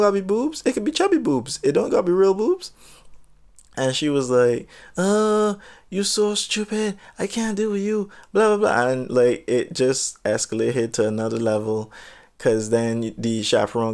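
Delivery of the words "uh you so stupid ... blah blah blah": mocking the girl. Imitating the girl waving the storyteller off, higher and dismissive. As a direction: brush him off in her voice.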